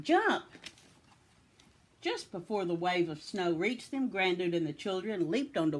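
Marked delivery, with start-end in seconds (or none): 0.0-0.4 high, loud one-word command; urgent cry. A narrator's reading. Grandude orders children onto cows, urgent cry. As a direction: shout the order to leap. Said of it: "cried Grandude".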